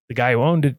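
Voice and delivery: usual speaking voice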